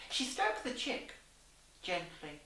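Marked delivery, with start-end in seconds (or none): none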